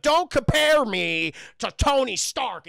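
loud, high, slow mock rage; upset outburst. Ultron upset at being compared to Stark, mock furious outburst. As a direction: bellow it like a wounded ego. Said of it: "he was very upset by that".

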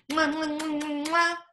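high-pitched "mwah" kisses; a string of playful smacking kisses; blown kisses. Real, not acted. Blowing kisses at goodbye.

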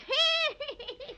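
high wicked cackle; witch cackle. Acted. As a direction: cackle over the spell. Witch prepares magic.

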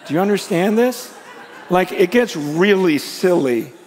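giddy laugh at how wild it is. Real, not acted. Preacher laughs at the wonder.

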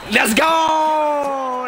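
high, loud, long-held cheer; real hyped-up holler; triumphant shout. Real, not acted. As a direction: yell in celebration, stretching the last word. Celebrates a stop.

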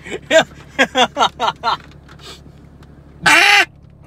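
loud high hail call blasts on a duck call; long loud quacking calls; duck call honks. Real, not acted. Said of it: "kinda wanna hail call on this thing".

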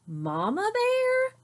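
high, slow amazed rise; stunned question. A narrator's reading. Brother learning his mother was the admirer, slow high amazement. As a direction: say it like you can't believe it.

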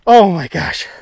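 loud, overwhelmed excitement; freaking out. Real, not acted. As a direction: marvel loudly at a huge catch. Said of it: "I'm freaking out".

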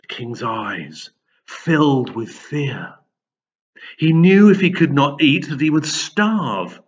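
plain reading voice

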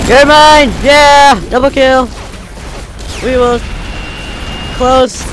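loud triumphant shout; excited yelling. Real, not acted. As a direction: cheer the kill loudly. Scoring kills in game.

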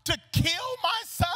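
high, loud and slowing accusation; accusing yell. Acted. Bereaved mother confronts the man of God, yelling. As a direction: yell it in anguish, stretching the last words. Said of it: "I will yell at the closest thing to God".

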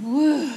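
loud voiced "woo" exhale; deep breath out. Mother and daughter collect themselves, deep exhale. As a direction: blow out a big calming breath. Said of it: "they let out a deep breath".

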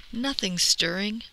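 usual speaking voice

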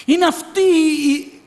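fiery preaching. Acted. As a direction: preach it to the whole church. Preaching at full force.

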